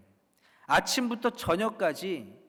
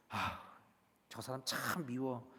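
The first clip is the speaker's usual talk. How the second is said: soft, lowered private complaint; quiet grumbling; 4 semitones lower, 12 dB quieter than usual. Acted. Worshipper resenting someone before service, quiet grumbling. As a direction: mutter it to yourself.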